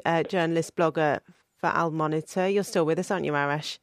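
normal talking voice

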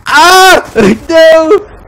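high loud yelp of frustration; frustrated scream. Real, not acted. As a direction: scream then laughing no. Killed by crossbow.

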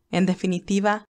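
plain reading voice

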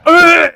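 very loud, high "uh"; exaggerated loud imitation grunt; mimicked guttural noise. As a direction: let out one sudden strained noise. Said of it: "it just sounds like I'm".

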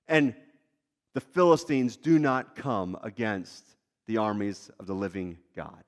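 calm the men's fears gently; reassuring David. Acted. David reassuring his frightened men, low and steady.

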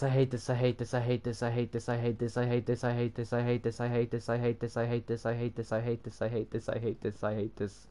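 quiet, rapid monotone loop; muttered mantra. Real, not acted. Fed up with the build, muttering on a loop. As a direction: repeat it softly without a break, sinking away. Repeats it under breath.

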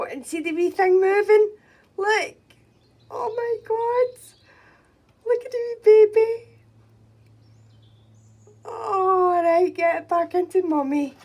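high, loud delighted squeal; thrilled. Real, not acted. Chick moving again, thrilled.

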